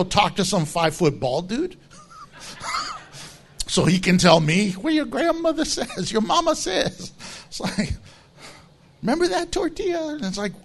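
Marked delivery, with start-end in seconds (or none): 4.8-7.1 high-pitched, hammed-up medium voice; mock spiritist. Mocks a spiritist relaying messages. 9.0-10.1 high, quick imitation of a medium; mock spiritist. Mocks a spiritist relaying messages.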